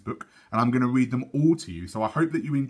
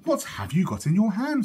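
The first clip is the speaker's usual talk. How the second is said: higher, curious mother voice; lightly voiced mum asking across the table; mother's question; 8 semitones higher than usual. Mum at tea asks Sophie, lightly voiced and higher.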